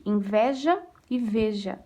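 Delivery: "Inveja" with an open é, "veja" with a closed ê, said slowly; slow contrast of open é in "Inveja" and closed ê in "veja". Shown as a demonstration. Teacher showing students the open é in "Inveja" and closed ê in "veja", slowly. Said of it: "O veja é mais fechadinho e o inveja é mais aberto".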